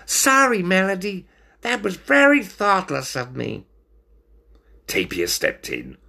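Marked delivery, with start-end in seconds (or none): 0.0-3.7 high, sheepish apology; pitched-up, contrite little voice; apologetic stoat. A narrator's reading. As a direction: say sorry in a squeaky voice. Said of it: "Feeling bad, Stoat said".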